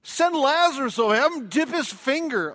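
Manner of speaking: loud, high-pitched cry for help; desperate plea. Acted. Rich man in hell begs for Lazarus, high desperate shout.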